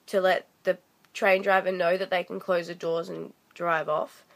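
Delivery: normal talking voice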